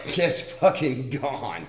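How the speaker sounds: slow, laughing through the words; amused, half laughing, slowed down. Real, not acted. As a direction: chuckle while saying it. Laughing at pictures of himself.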